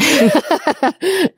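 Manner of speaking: a high squealing laugh, real amusement; gleeful high-pitched laugh; high burst of laughter. Real, not acted. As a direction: break into giggling high up.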